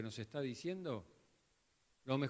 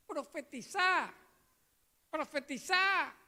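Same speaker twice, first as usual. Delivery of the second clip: much higher, slow, put-on voice mocking a false prophet; 14 semitones higher, 5 dB louder, slower than usual. Preacher parodying YouTube false prophets, high mock voice. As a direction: ham up a laughing YouTube prophet calling out.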